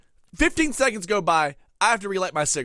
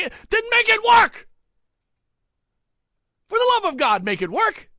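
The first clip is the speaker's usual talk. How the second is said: angry shout; 12 semitones higher, 4 dB louder than usual. Acted. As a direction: bellow it at the phone. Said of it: "Sorry for yelling".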